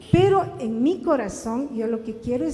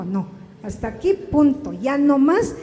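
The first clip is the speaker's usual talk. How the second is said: imitating a husband putting his foot down, louder on the last words; firm refusal; 2 semitones higher, 5 dB louder than usual. Husband rebels against his wife's manipulation, firm refusal.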